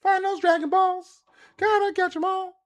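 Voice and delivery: hammed-up kids' show promo; TV announcer. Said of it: "they were airing".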